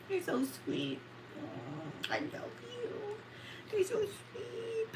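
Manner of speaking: high soft cooing; touched and tender. Real, not acted. Thanking Jade for the donation, high soft coo. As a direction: coo your thanks softly.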